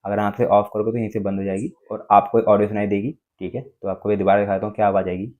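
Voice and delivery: ordinary delivery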